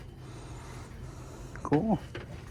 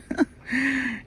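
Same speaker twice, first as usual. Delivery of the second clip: high laugh. Real, not acted. Laughs at own situation.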